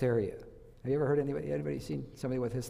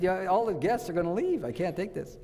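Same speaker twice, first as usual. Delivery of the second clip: exasperated; 6 semitones higher than usual. Retold or imitated. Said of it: "I said".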